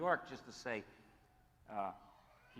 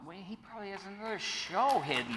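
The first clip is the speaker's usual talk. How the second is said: pitched-up joking suspicion, lightly played; mock suspicious; 8 semitones higher, 6 dB louder than usual. Telling the panel, in mock suspicion. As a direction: tease that he's hiding another show.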